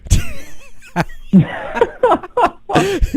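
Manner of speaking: laughs hard, high-pitched; high burst of laughter. Real, not acted. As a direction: break into a giggling fit. Laughs at own joke.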